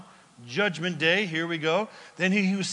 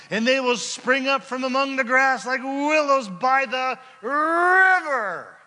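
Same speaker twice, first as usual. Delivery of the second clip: rising fervour; 9 semitones higher, 9 dB louder than usual. Real, not acted. Building reading climax.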